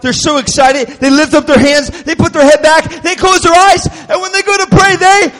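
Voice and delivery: breathless-style build, ending high and suspended; building excitement. Retold or imitated. Acts out excited children building up.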